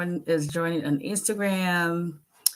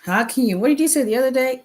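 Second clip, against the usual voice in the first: quoting son; 8 semitones higher, 5 dB louder, faster than usual. Retold or imitated. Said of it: "And he goes".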